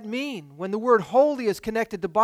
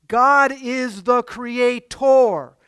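slow and louder, every word weighted; emphatic preaching; 3 semitones higher, 5 dB louder, much slower than usual. Acted. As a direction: pound out each word from the pulpit. Preacher hammers the key point.

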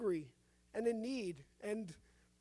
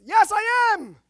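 high, loud defiant yell; patient shouting back at the doctor, defiant; cried all the louder; 11 semitones higher, 16 dB louder, slower than usual. A narrator's reading. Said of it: "he cried all the louder".

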